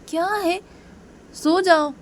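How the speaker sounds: exasperated bedtime command; irritated order. Acted. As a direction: shut the nagging down sharply. Ruhi orders Piyu to sleep.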